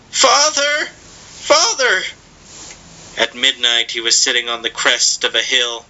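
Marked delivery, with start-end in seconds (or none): 0.1-2.0 high, sobbing cry for father; boy sobbing. A narrator's reading. Boy running, crying for his father, high sob. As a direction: wail it out in grief. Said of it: "panting, sobbing".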